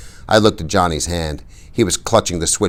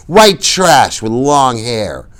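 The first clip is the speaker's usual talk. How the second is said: slow, louder sneer; slow insult; 7 semitones higher, 6 dB louder, much slower than usual. A narrator's reading. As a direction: drag out the insult with contempt. Bob's insult.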